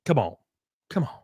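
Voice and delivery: ordinary talk, nothing marked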